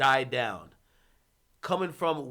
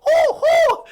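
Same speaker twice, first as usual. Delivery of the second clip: high, loud mock owl hoot; 12 dB louder than usual. [name] scaring a neighbor with owl hoots, high and loud. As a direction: hoot like an owl to spook him. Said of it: "how the bird goes".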